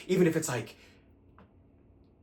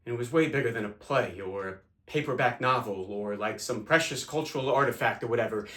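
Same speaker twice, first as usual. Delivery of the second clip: usual speaking voice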